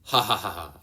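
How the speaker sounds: lightly voiced mocking laugh; a hint of a gloating villain laughing; Arlong's laugh. Arlong mocking Nami, lightly voiced laugh. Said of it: "she hears Arlong's laugh".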